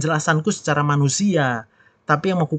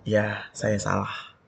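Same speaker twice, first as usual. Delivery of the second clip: slow, soft, lowered voice; gentle admission; 5 semitones lower, 8 dB quieter, much slower than usual. Acted. Wife owning up to angry husband, slow and gentle. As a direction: give in gently and slowly. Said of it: "Jawaban yang lemah lembut".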